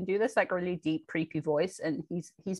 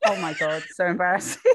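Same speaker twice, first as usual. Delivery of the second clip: ordinary delivery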